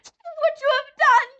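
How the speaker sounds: very high, loud shrieking witch; witch screaming. A narrator's reading. Melting witch screaming at Dorothy, very high and loud. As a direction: shriek in horror as she melts. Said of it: "she screamed".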